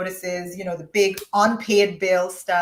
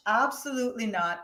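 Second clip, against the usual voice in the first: ordinary talk, nothing marked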